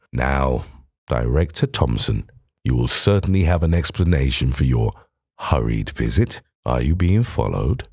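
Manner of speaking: low, calm interrogating voice; probing Holmes. Acted. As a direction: question him coolly and low. Holmes questions Thompson.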